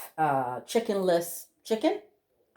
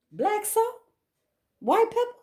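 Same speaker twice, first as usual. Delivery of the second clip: much higher, teasing questions; showing off the spice jars in a playful sing-song; playful mock question. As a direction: tease each spice name up high.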